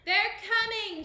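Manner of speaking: high, loud, drawn-out shout; excited shout. A narrator's reading. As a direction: yell the alarm from the hilltop. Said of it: "shouted the lookout excitedly".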